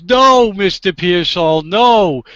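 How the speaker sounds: retold exasperation, hitting each "no"; exasperated judge. Judge correcting a confused lawyer, loudly exasperated. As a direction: imitate a judge's loud, emphatic correction.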